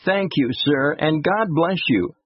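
put-on high, earnest little-boy voice; grateful child. A narrator's reading. Boy thanking the driver for helping his brother, grateful. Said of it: "the grateful child said to him".

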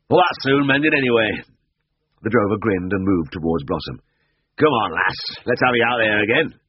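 easygoing farmhand coaxing; cheerful drover. A narrator's reading.

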